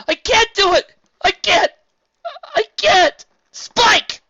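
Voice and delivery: high, loud screaming breakdown. A narrator's reading. Twilight screaming at Spike in despair.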